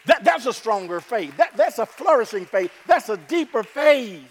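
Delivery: loud, high ringing proclamation; preaching climax. Acted. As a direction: build it up and proclaim.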